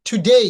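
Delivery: টুডে as "thu-dei", loud, rising end; firm model of টুডে, aspirated T; long EI ending. Shown as a demonstration. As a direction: punch out টুডে with a gliding "ay". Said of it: "যার কারণে এখানে হচ্ছে".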